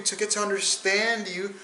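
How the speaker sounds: ordinary talk, nothing marked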